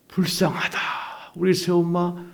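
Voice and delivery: high, plaintive retold lament; re-enacted wave of pity. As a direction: draw it out like a mournful cry. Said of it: "이런 마음으로 확 바뀌는".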